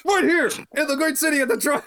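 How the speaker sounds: high, loud, fast hype-man call; big high-pitched arena shout; announcer shout. Acted. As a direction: belt it out like a ring announcer. Sudden mock announcer outburst.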